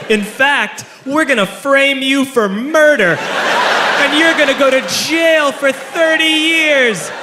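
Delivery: high, slow gloating; hammed-up evil airline agent; villainous threat. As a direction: relish each word of the threat, pitch climbing.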